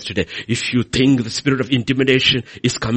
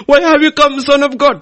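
demons' cry, higher and louder; demons crying out; 12 semitones higher, 7 dB louder than usual. Acted. Demons confronting Jesus in synagogue, loud high cry. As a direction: shout it as the startled demons. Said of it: "he said".

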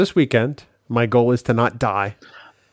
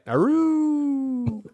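high howling aroo; wolf howl; 4 dB quieter than usual. Acted. Podcast intro howl.

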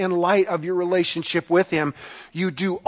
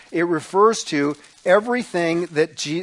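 usual speaking voice

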